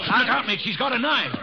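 alarmed warning shout. Acted. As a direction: scream the warning across the room. Dave warns Mitch of knife.

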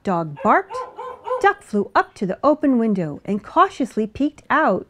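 ordinary delivery

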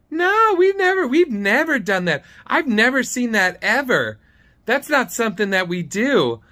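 high, emphatic mock denial; high-voiced caricature insisting; Dee's high-pitched voice. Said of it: "She has this high-pitched voice".

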